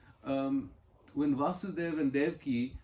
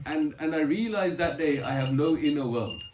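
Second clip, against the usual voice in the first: ordinary talk, nothing marked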